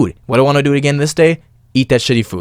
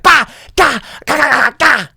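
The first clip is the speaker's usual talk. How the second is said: amped-up noises; 11 semitones higher than usual. Retold or imitated. Host acts out an amped-up listener, high explosive noises. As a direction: fire off hyped syllables like pounding music. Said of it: "You are amped up".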